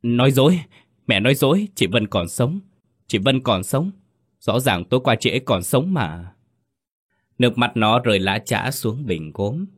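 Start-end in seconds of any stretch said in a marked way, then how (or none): 0.0-6.3 screaming denial. Acted. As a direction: yell it at the mother, refusing the news. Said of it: "Nó gào lên".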